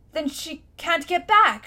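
loud, high, slow cry; shouted. Acted. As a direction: cry out in alarm. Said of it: "shouted Diggory".

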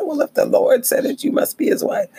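very high mocking tease; teasing. Narrator teasing her upset friend, very high and mocking. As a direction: rib your friend in a high sing-song. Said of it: "I was teasing her".

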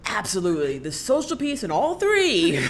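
loud, high burst rising into a laugh; big, loud, amused outburst; emphatic agreement. Real, not acted. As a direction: exclaim it emphatically, ending in laughter.

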